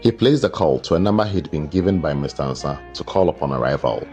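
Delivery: ordinary delivery, nothing marked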